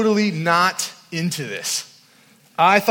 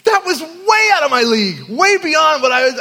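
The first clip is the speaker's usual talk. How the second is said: emphatic exclaim; 8 semitones higher, 6 dB louder than usual. Real, not acted. Overwhelmed exclamation to congregation.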